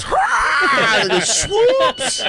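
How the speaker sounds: cheering shout. Retold or imitated. Mimicking mascot at wedding.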